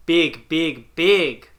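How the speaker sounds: loud repeated exclamation; insistent pointing. Acted. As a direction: call out each word separately. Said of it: "she points over, uh, to the corner and says".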